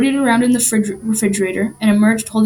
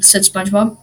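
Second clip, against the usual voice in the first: usual speaking voice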